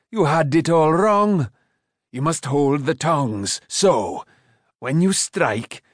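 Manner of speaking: put-on slow, deliberate old craftsman; instructing smith. A narrator's reading. Smith showing the boy how to strike, instructing. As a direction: teach it with relish, in character. Smith lecturing his pupil in character.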